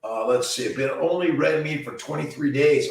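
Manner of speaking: normal talking voice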